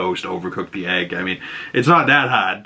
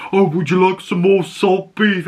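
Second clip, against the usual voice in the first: higher, mocking offer; teasing; 8 semitones higher, 3 dB louder than usual. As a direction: needle a friend with fake politeness. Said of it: "teasing each other, and he's like".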